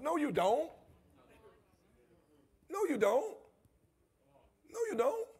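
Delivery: rising emphatic denial. Acted. Emphatic refusal repeated.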